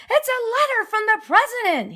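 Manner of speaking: high, breathless gasp of shock. A narrator's reading. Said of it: "he gasped".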